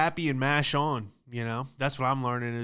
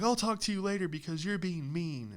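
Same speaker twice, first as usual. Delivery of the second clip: higher, a touch of mock offense; huffy girl; 5 semitones higher, 4 dB quieter than usual. The girl ending the text fight, higher huffy mock voice. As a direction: sniff it like you're hanging up on him. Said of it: "She's like".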